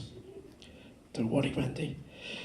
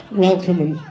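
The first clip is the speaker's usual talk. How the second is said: laughing; 3 semitones higher than usual. Real, not acted. Laughing through his own joke.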